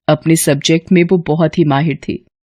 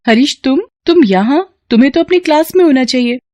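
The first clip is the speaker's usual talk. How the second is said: higher voice, taken aback then brisk; surprised teacher Kamya; 8 semitones higher than usual. Acted. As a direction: catch a student where he shouldn't be. (ऊँची आवाज़ में हैरान teacher)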